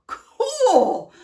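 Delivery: a bigger burst of awe, high and loud. A narrator's reading. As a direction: let your awe climb. Reacting to a cool bean's talent.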